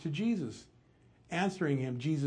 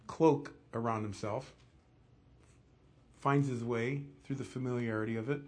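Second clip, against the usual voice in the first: normal talking voice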